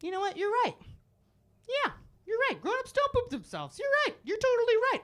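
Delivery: sarcastic sweet agreement. Retold or imitated. Teacher fake-agreeing with the sassy girl, sugary high. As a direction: agree sweetly while plotting.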